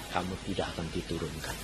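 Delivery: very low, hushed pronouncement; God's voice. Retold or imitated. God promising the pupil he will stay, hushed divine voice. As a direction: speak deep and softly, as a quiet assurance. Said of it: "Tuhan bilang".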